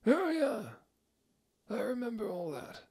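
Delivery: high, far, breathy put-on gothic voice; mock gothic. A narrator's reading. Headmaster trying to sound gothic to student. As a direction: try too hard to sound gothic. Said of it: "trying to be all gothic".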